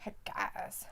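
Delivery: breathy unsure whisper. Real, not acted. Co-host shrugs off the question in a whisper. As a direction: whisper it, half to yourself.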